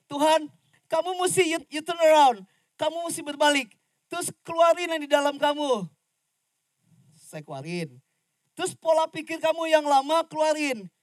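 high, loud fiery sermon voice; full-force preaching. Acted. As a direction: preach it out to the whole congregation. (khotbah berapi-api dengan suara keras dan tinggi)

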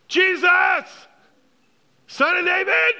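loud, high-pitched desperate shout; crying out. Acted. Blind outcast calls to Jesus walking by, crying out. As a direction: yell it over the crowd, high and loud. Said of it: "he kept crying out all the more".

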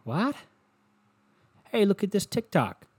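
high, incredulous mock voice; mock disbelief. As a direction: act baffled by what you heard. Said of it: "you go".

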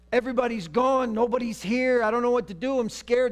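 high, loud, panicky mock plea; pleading Saul. Saul begs Samuel's spirit for help, high and panicky. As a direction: beg for help like a scared king.

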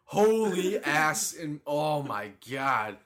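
high laughing disbelief that slows and settles; laughing, winding down. Real, not acted. After the car teleports he laughs, then calms down. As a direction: laugh it out, then trail off amazed.

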